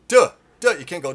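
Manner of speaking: normal talking voice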